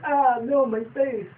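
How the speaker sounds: high squeal of pain; mock pain cry. Spike hit in the face, high mock yelp. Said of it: "he hits Spike in the face".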